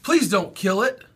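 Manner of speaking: high, loud tearful plea; sobbing plea. A narrator's reading. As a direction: beg through sobs. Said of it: "she sobbed".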